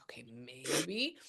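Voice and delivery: slow, hushed doubtful reply; half-whispered reluctant concession. Retold or imitated. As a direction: draw it out softly, unconvinced. Retelling own doubtful reply.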